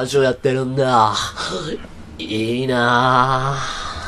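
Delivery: low, long-drawn wistful sighing; envious onlooker. Retold or imitated. Host imitating the writer who wanted in on the show, wistfully envious. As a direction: play someone longing to join in, vowels stretched out. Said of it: "って言ってなんか".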